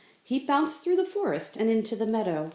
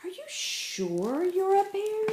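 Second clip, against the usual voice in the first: much higher, slow wondering ask; curious question; 12 semitones higher than usual. Acted. Bunny Bear asking Grizzly Bun, high and wondering. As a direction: ask with wide-eyed doubt.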